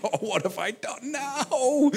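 slow, laughing mock dread; a schoolkid's playful groan of worry; mock worried pupil. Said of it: "It's like".

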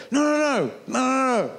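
put-on a boy insisting he can do it; eager young David. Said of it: "David said".

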